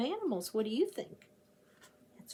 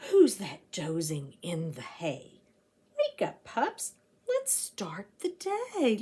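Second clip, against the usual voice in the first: put-on reading to children; 3 semitones lower than usual. A narrator's reading. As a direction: read it like waking the pups. Reads rhyming picture book aloud.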